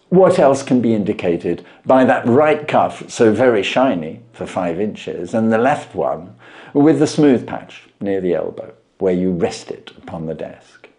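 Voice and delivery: measured, knowing detective voice; Holmes voiced. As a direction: lay out the deductions with calm assurance.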